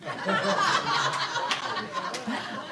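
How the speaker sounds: breathy chuckling; soft, drawn-out laugh. Real, not acted. Speaker joking about grandma's warning on laughing, soft laugh.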